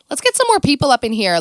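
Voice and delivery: ordinary talk, nothing marked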